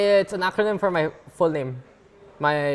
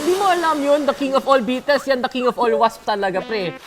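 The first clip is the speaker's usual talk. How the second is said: louder, higher Tagalog commentator calling the action; Tagalog play-by-play; 5 semitones higher, 5 dB louder than usual. Pretend. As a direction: hype up the wasp chase like a Filipino caster. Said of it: "Oh, sige, Tagalog".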